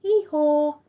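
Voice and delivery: loud high hee-haw bray; donkey bray. Acted. Mum braying the donkey's hee-haw for her son, loud and high.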